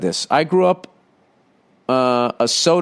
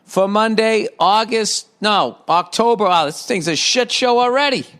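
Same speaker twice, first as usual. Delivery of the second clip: high, drawn-out show opening; sing-song host greeting, higher pitched; podcast intro; 6 semitones higher than usual. Acted. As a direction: belt out the intro, stretching the last words upward.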